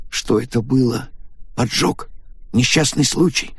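put-on anxious young man. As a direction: press for answers, uneasy. Takaaki asks what happened.

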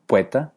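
POETA as PUE-TA, rising as a question; transformed diphthong. Shown as a demonstration. Teacher offers the third option, pueta with altered vowel. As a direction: turn the O of poeta into a U glide. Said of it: "transformando una de las dos vocales".

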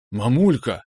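delighted cry of Mom; admiring exclamation. A narrator's reading. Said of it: "воскликнул я восхищённо".

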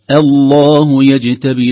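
loud slow chant, "الله" opening on thin "a"; light hamza. Shown as a demonstration. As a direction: begin "الله" light before the heavy lām. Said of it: "كذلك". («الله» بترقيق الهمزة)

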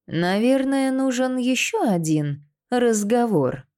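high, drawn-out veiled threat; hint at menace in a high, ringing voice. A narrator's reading. Queen hints at another interrogation, lightly voiced ringing threat. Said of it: "В звонком голосе Матильды звучала угроза".

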